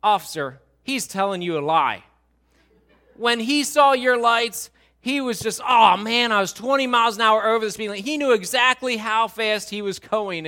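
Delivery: fed-up spouse exposing a lie, pressing harder; irritated wife. Acted. Irritated wife tells the officer on her husband, exasperated. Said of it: "with kind of an irritated face".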